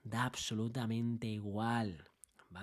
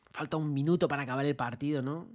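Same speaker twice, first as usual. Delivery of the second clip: usual speaking voice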